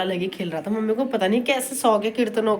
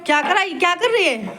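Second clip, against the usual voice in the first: high scolding; 8 semitones higher, 3 dB louder than usual. Real, not acted. Scolding the baby.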